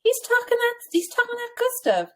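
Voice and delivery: high, admiring mom voice; imitating mom. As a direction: gush like mom about her idol. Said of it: "But she's like".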